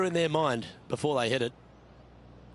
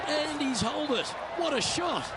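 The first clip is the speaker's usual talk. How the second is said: thrilled, much higher commentator call; excited; 10 semitones higher than usual. Acted. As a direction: call the hole-out with delight. Ball holed from distance.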